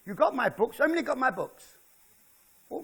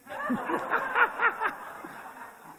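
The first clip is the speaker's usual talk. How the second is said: long high wheezing laugh; big amused laugh; hearty laugh. Real, not acted. Laughs at own joke.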